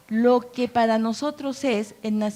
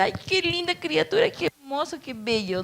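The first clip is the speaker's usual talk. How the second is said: cooing mother; 5 semitones higher than usual. Pretend. New mother admiring her baby.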